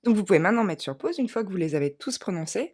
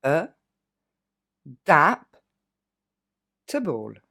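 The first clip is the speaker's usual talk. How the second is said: E, dap, table with pauses between parts; split into syllables; 4 semitones lower, 4 dB louder, slower than usual. Shown as a demonstration. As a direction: chop adaptable into three pieces. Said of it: "on le découpe". (découpé en trois syllabes)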